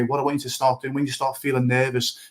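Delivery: normal talking voice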